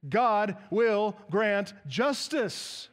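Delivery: very slow, each word hit hard; a measured, forceful proclamation, almost shouted; emphatic near-shout. Retold or imitated. Preacher voicing Jesus' affirmation to the congregation, slow emphatic near-shout. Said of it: "He's almost shouting his affirmation".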